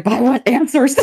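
high, playful plea; mock complaint rising into a laugh; mock demanding. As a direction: act out an impatient demand. Plays her impatient self, then laughs.

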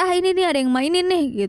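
imitating an uncle's rising irritation; annoyed uncle. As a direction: grumble it like a fed-up uncle. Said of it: "Omku tuh kesel".

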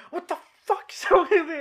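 very high-pitched startled outburst; mock screaming. Sleeper startled by an intruder, high mock scream. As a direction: pretend to wake up shrieking. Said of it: "And then start screaming".

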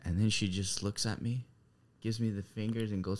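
usual speaking voice

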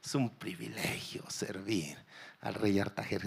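whispered mock-happy servant line; mock contentment. Servant whispering feigned delight about serving the king. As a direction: whisper it like forced bliss. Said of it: "poner una cara de".

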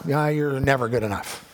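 normal talking voice

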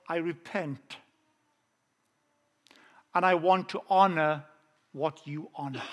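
ordinary delivery

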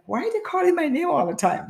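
high-pitched mock bewilderment; retold puzzlement. Runner baffled by strangers calling her name, puzzled. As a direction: wonder aloud in a high voice.

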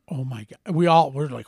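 ordinary talk, nothing marked

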